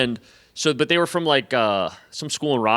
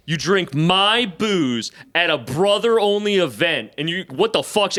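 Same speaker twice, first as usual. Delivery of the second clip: going off; 5 semitones higher than usual. Retold or imitated. Frat guy going off on an intruder, indignant and emphatic. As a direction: lay out each offence, raised pitch. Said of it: "he starts, like, going off on me".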